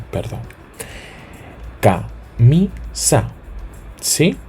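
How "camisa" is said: "Camisa" drawn out, stress on "mi"; slow stress on penultimate. Shown as a demonstration. Teacher demonstrates stress in "Camisa", slowly and clearly.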